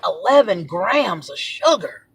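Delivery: slow, drawn-out disclosure; slow reveal. Retold or imitated. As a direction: let the damning figure out slowly. First reading of the sugar content.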